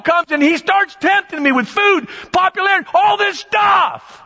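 high-pitched, excited acted exclamations; high, animated retelling with the last words stretched; worked-up testimony. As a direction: play someone reeling from temptation, pitched high. Acting out Jesus being tempted.

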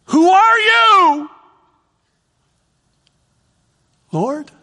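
high, slow, loud cry; loud awestruck shout. Shown as a demonstration. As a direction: shout it out in awe. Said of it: "two ways he could say this".

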